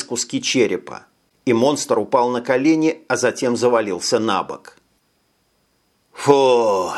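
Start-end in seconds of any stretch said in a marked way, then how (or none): 6.2-6.9 heavy breath out; relieved exhale. A narrator's reading. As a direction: blow out in relief. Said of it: "выдохнул Джим".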